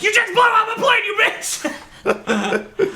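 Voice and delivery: yelling furiously at the screen; angry shout. Retold or imitated. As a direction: scream it in outrage. Angry rant at the show.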